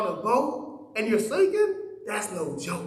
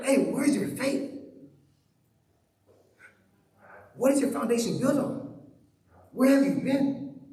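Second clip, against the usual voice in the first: challenging question; 3 semitones higher than usual. Pretend. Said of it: "we're like".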